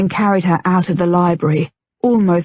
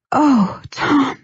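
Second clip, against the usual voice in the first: higher, breathless gasp of his name; 6 semitones higher than usual. A narrator's reading. Wife reacting to her husband's touch, in a breathless gasp. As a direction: catch your breath on it. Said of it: "she gasped".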